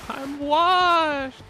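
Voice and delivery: high, loud despairing wail; dramatic wail. Real, not acted. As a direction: wail like it's all over. Repeated, yelled.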